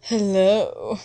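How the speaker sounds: ordinary talk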